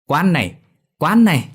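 high, loud teasing yell; playful shout. A narrator's reading. Boys pile onto Dậu, yelling playfully. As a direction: shout it while tackling him.

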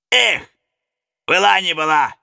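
high, loud reckless cry; young daredevil. Acted. Young daredevil stepping out before the robbers, high reckless cry. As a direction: throw caution to the wind. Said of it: "молодой сорвиголова".

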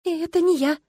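high, pleading little voice; as pitiful as possible. A narrator's reading. Girl denies blame to Kastro, as pitifully as possible. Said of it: "прошептала как можно жалостливее".

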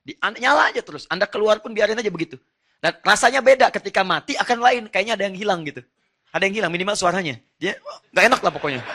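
preacher swept up in his point; excited preaching. Real, not acted. Preacher praising the house filled with Quran, excitedly.

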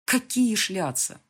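wife's slow indignant retort; slow indignant question. A narrator's reading. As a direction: drag out the outrage.

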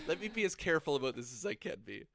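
ordinary talk

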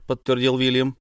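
plain reading voice

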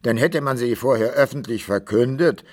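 ordinary talk

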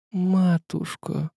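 high, faint, drawn-out murmur; whispered. A narrator's reading. Slapped son to his mother, whispering. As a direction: breathe it slowly and very softly. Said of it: "прошептал Мудрик".

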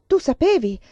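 loud, high, furious interrogation; yelling. A narrator's reading. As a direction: shout demands as if shaking someone. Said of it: "scotendomi urlò".

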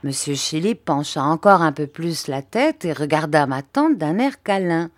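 plain reading voice

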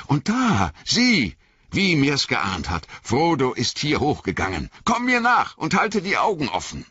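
high, urgent leader on the trail; keyed-up tracker calling orders; Aragorn, urgent and alert. Acted. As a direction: urge a companion on with rising excitement. Aragorn urges Sam on.

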